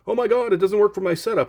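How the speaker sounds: high, fast panicked whine, mocking the buyer; mock freak-out. Buyer emailing the seller in a panic, mock high freak-out. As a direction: imitate a buyer losing it over nothing. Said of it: "emails me back freaking out going".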